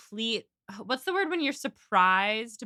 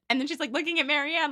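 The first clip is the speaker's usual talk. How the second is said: ordinary talk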